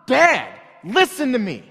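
loud, high, furious yell; angry shout. Retold or imitated. Father losing it with fighting kids, yelling. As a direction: blast it out at the kids. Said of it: "I get angry".

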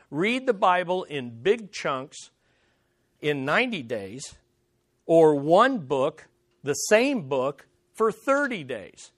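slowly, phrase by phrase, with pauses between; deliberate repetition. Real, not acted. Preacher restating the reading challenge to the congregation, slow and deliberate. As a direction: spell out the instruction slowly for emphasis. Repeats the point slowly for emphasis.

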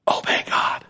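hushed whisper of the secret. Acted. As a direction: lean in and whisper it. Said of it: "Here it is".

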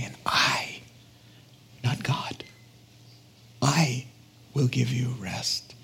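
near-whisper with long pauses; breath-soft, deliberate quote; hushed invitation. Retold or imitated. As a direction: let each phrase drop softly into silence. Jesus's invitation, voiced slowly and softly.

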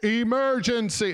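drawn-out, high imitated yell; imitated alarm cry, stretched out high; top-of-lungs yell. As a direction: holler it slowly to the whole restaurant. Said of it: "he yells at the top of his lungs".

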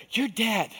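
Satan's verdict; accusing. Retold or imitated. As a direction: spit it at him. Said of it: "voice of accusation".

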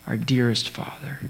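our dearest father said softly, leaning on dearest; whisper-soft, loving repeat of dearest; hushed and intimate, stressing dearest; tender dearest father. Shown as a demonstration. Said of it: "the best translation ... would be to say".

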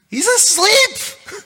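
He's asleep squealed very high, slow and loud; mock amazed squeal. Shown as a demonstration. Preacher mocking the sleeping Jesus with a high slow squeal.

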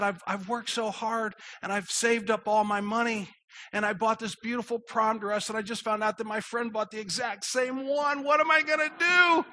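mock sobbing. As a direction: imitate a distraught teen, climbing to a hammed-up wail. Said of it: "just is sobbing".

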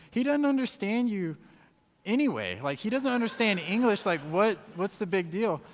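high, loud, incredulous retort; imitated exasperated wife. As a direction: mimic a wife brushing off objections. Acts out wife brushing off objections.

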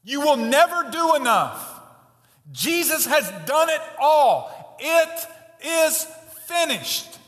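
loud, slow proclamation; ringing pulpit declaration; full-force preaching. Acted. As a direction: pound each phrase home from the pulpit. Preacher's climax, held full force.

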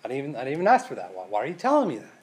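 rushed, puzzled pushback; baffled protest. Pretend. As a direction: blurt out the confusion fast. Paralytic objects to unasked forgiveness.

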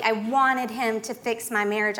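ordinary delivery

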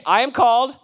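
slow, loud, drawn-out chant; call-and-response. Shown as a demonstration. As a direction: lead them word by word. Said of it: "Say it with me".

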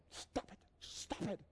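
very soft, whispered scolding; urgent whisper. Retold or imitated. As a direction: whisper it so nobody nearby hears, imitating an embarrassed friend. Hissing at friend embarrassing him.

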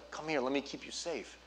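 soft, protective father voice; tender father. Retold or imitated. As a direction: gently coax a child closer. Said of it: "your Father, is, all he wants".

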